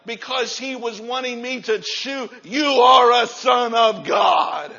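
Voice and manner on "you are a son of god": slow shout. Real, not acted. Preacher shouts declaration.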